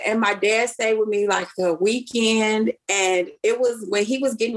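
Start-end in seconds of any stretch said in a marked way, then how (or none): none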